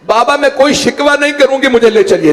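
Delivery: high-pitched plea from a daughter to her father; tearful daughter pleading. Retold or imitated. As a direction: plead as if tears were just wiped away. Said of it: "ایک مرتبہ آنسو صاف کیے".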